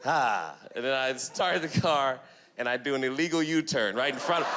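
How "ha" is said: usual speaking voice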